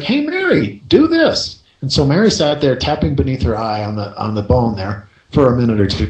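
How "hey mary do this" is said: high, light mock voice of the doctor; retold Callahan's request. Doctor casually telling Mary to tap, mock high voice. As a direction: pitch it way up playfully. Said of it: "he's like".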